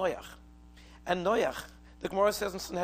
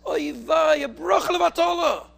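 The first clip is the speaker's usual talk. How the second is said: high, loud shocked cry; alarmed outcry; 9 semitones higher, 11 dB louder than usual. Retold or imitated. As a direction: blurt it out in sudden alarm. Husband's shocked outcry in story.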